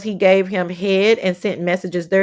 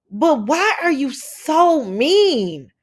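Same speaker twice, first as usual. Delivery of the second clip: exasperated; 4 semitones higher, much slower than usual. Real, not acted. Scolding streamer Sir King.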